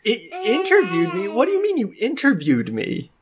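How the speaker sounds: high, hesitant, bewildered voice; flustered townsman. Acted. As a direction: stammer like a startled villager. Game master plays a flustered townsman.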